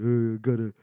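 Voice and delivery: low, dopey mock voice; dumb guy.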